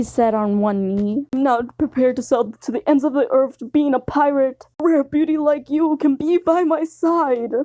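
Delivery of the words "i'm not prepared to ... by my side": swooning lover. Acted. Sanji on one knee to the listener, swooning. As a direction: ham up a lovesick sigh.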